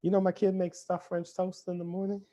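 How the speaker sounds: a hint of a boastful parent; proud parent bragging. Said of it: "You'll be like".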